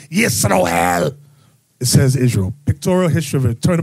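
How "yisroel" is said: Yisroel, with "s" and an "o" vowel; alternative Hebrew pronunciation. Shown as a demonstration. Offering another way to pronounce Israel, Yisroel. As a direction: say Yisroel as the other Hebrew form. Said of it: "however you want to pronounce it".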